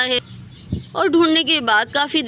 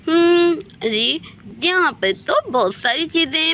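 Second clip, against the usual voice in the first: put-on baby talk, musing aloud in the kitchen; childlike Saman, babyish; 2 semitones higher than usual. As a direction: play a childish girl talking to herself. Voices childlike Saman playing in kitchen.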